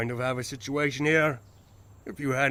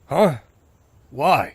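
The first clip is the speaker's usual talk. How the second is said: startled protest; 10 dB louder than usual. Acted. Startled at being told to stay.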